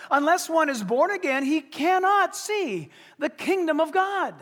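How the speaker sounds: rising, slowed emphasis on every word; emphatic. Retold or imitated. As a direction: stress it like a command. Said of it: "All of this is in the emphatic".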